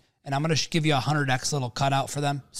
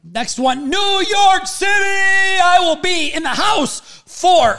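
hype shout; 9 dB louder, much slower than usual. Acted. Hyped announcement shout.

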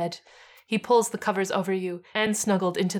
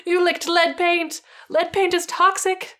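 upset pleading; 7 semitones higher, 3 dB louder than usual. Acted. Bob scolding then apologizing.

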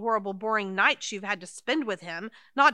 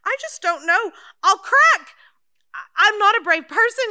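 frightened; 7 semitones higher, 7 dB louder than usual. Acted. Frightened friend fears cracking.

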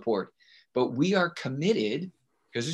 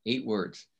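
usual speaking voice

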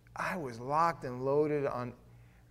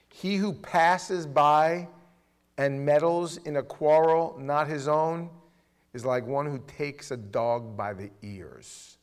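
slow, louder, weighty proverb recital; scripture reading; 5 dB louder, slower than usual. Acted. Preacher reading the proverb to the congregation, slow and weighty. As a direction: lay each phrase down like scripture.

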